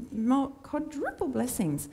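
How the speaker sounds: badly imitated accent, high and drawn out; Hugo's accent. Preacher reprising the in-joke about a former member, mock accent. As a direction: stretch it out slowly in a put-on accent. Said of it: "I'm doing the accent".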